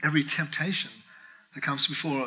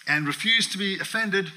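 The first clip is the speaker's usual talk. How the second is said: ordinary talk, nothing marked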